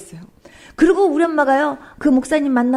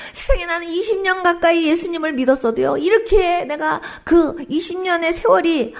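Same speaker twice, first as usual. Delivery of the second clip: re-enacted pleading, building higher and more urgent; retold tearful prayer; 3 semitones higher than usual. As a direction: plead with God as if crying. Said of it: "울면서 기도했어요".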